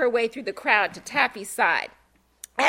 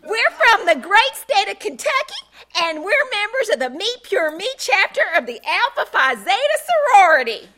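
put-on much higher, louder perky Southern sorority girl; proud sorority girl; 10 semitones higher, 8 dB louder than usual.

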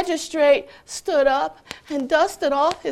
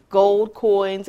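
usual speaking voice

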